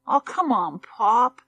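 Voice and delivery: high, loud wheedling whine; whiny boy. Acted. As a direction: nag Pop to give in. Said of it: "in a whiny voice".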